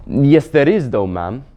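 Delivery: hint of a stubborn Marshwiggle; Puddleglum the Marshwiggle. Marshwiggle contradicts the Queen about Narnia, firmly.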